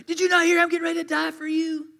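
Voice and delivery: high, loud exasperated rant; mad outburst. Acted. Preacher as a fed-up Jesus scolding the disciples, mad and exasperated. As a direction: explode in disbelief, pitch way up. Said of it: "I would have gotten so mad".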